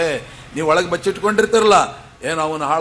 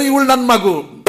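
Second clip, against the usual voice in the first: put-on higher and louder, a doting father insisting she is his baby; raised, emphatic father's voice; fond father; 8 semitones higher, 5 dB louder than usual. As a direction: claim her proudly as a father would. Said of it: "ಆದರೂ ಹೇಳೋದೇನು".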